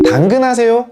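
high, loud eager question to a stranger; asking "are you Danggeun?". Retold or imitated. Stranger greeting a buyer outside the apartment, high and loud. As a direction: call out brightly to the trade partner. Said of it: "요렇게 물어보는 얘기들".

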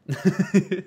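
short amused laugh. Real, not acted. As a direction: burst into a brief chuckle. Laughs at a donated message.

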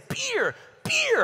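put-on high little kid voice saying "Beer, beer"; toddler voice. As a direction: play the small son mispronouncing bear. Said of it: "he'd say".

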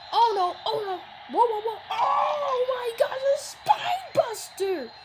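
high, slow, loud hyped yell; hyped YouTuber shout. Acted. Mocking other YouTubers with a high, slow hyped shout. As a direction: stretch it out like an overhyped YouTuber. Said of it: "That's like other YouTubers".

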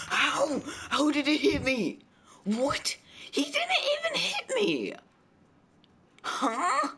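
shrieking high outrage; raging gamer. Real, not acted. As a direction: screech in disbelief at the hit. Raging at being hit.

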